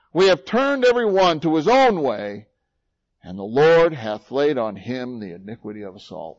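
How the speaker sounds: fiery pulpit delivery, slowing on stripes and chastisement; preaching at full force. Acted. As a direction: proclaim each line like a wound laid bare. Continues the Isaiah reading.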